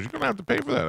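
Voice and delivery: high mock lady voice; rental lady. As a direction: clerk warns customer, high mock prissy voice. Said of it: "She goes".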